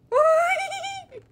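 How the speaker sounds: high, loud shriek of laughter. Real, not acted. Player caught off guard, shrieking laughter. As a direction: let a squeal of laughter burst out. Burst after a game event.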